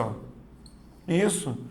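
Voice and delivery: normal talking voice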